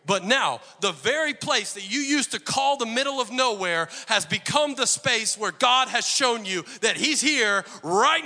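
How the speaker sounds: loud, high, driving sermon delivery; preaching at full force. Acted. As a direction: preach it hard to the congregation.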